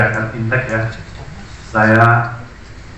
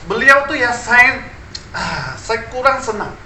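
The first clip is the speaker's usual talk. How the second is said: mock polite complaint, pitched up; lightly voiced courteous speaker, high; imitating a polite Chinese speaker; 9 semitones higher, slower than usual. Pastor imitates how a Chinese friend politely complains, mock polite. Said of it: "Tapi begini ngomongnya".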